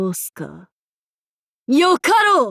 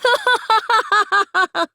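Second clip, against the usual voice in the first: evil cackle; 10 semitones higher than usual. Acted. Evil laugh.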